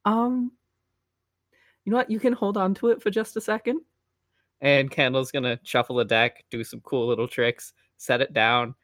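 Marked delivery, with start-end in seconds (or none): 0.0-3.8 higher, lilting performer voice; young magician character. Acted. Magician letting the boy hold the card, polite performer voice. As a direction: play a polite magician, pitched up.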